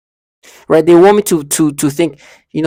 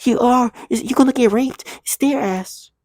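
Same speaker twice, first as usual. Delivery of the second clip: high childish mocking voice; high imitation of a child taunting; childlike voice; 10 semitones higher than usual. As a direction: mimic the childlike taunting voice, pitched way up. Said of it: "That's the thing she says".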